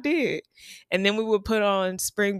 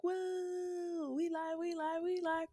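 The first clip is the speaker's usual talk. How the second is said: high, much softer half-hearted woo; tired crowd reply; 10 semitones higher, 12 dB quieter, slower than usual. Retold or imitated. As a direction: answer back limp from the heat. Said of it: "we would be like".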